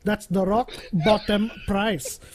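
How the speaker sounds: put-on high, loud Indian-accent haggler; final-offer barter, put-on Indian accent. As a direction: bargain emphatically in a mock Indian accent. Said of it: "in an Indian accent is barter".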